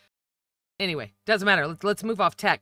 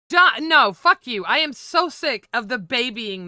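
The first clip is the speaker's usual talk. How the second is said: louder and higher, spitting out the curse; angry outburst; 4 semitones higher, 5 dB louder than usual. Real, not acted. Podcaster curses at the condescending host, angry and loud. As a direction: snap back at him, fed up.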